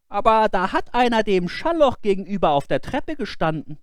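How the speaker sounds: put-on high, naive boyish voice; an innocent boy explaining; boy's voice. Boy answers the wife.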